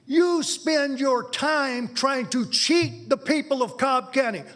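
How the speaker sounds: ordinary talk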